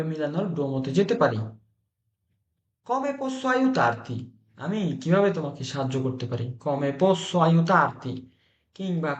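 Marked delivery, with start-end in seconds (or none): 2.9-4.2 slow, high Italian question; high, stretched-out teaching example; high-pitched model phrase. Shown as a demonstration. Teacher models offer of help. 6.6-8.1 very slow question in usual pitch; slowly enunciated classroom repeat; slow teaching repeat. Shown as a demonstration.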